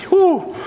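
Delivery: high joyful whoop; excited whoop. Real, not acted. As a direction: let out a happy holler. Preacher exults over finish line.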